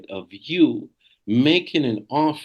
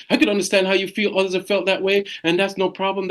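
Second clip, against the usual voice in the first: quick, higher reassuring line; fast, lightly lifted reassurance; 9 semitones higher, faster than usual. Shown as a demonstration. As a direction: rattle off the verbal bridge fast. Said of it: "I said it fast".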